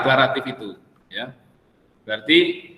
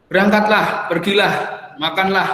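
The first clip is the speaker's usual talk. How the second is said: short, raised-pitch orders; imperative commands; 5 semitones higher than usual. Shown as a demonstration. Teacher showing students what the exclamation mark is for, commanding. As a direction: issue each word as a separate command. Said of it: "untuk perintah".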